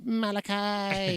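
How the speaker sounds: high, long-drawn mock call; imitated kid yelling. Imitating the yelling kid from Children of the Corn, drawn-out mock yell. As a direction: stretch the name out high, without real volume. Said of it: "running around yelling".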